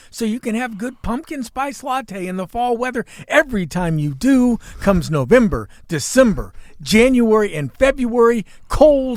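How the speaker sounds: loud, high-pitched comic tirade; worked-up, emphatic grievance; mock rant. Real, not acted. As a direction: rail against it, hitting each month hard. Mock rant against pumpkin spice season.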